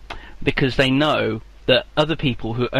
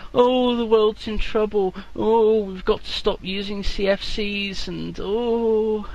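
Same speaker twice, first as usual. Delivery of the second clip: mock alarmist; 8 semitones higher than usual. Mocking climate campaigners.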